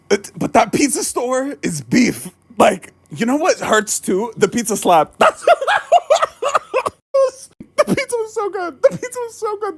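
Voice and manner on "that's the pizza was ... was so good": high, whiny fake sob; mock crying. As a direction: wail it like a heartbreak. Mock crying over pizza.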